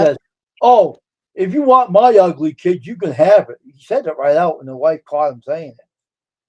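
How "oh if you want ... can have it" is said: higher, much louder retold outburst; loud joking retort. Friend retelling a buddy's ugly-kid joke, loud joking retort. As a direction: blurt it out loudly, imitating a joking friend.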